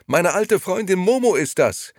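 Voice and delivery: a light laughing exclamation; laughing call. Acted. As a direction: call it out cheerfully, half laughing. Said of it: "rief Gigi lachend".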